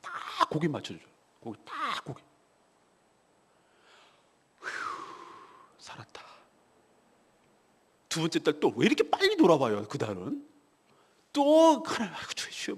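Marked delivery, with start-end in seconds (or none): 4.6-6.2 hushed, spent sigh of relief; relieved whisper. Retold or imitated. Pastor retelling his relief at scraping through the interest payment, relieved whisper. 12.1-12.8 retold desperate prayer. Retold desperate prayer over debt.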